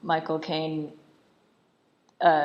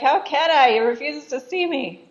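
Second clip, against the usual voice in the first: high, louder mock protest; exasperated protest; 10 semitones higher, 7 dB louder than usual. Wife defending herself over the emperor, exasperated mock protest. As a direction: throw up a helpless, indignant defence.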